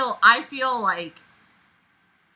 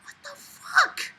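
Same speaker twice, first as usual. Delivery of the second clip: much higher, drawn-out slow squeak; shocked disbelief. Real, not acted. Reader stunned by a line in the story, high squeak. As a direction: let the disbelief pull the pitch way up.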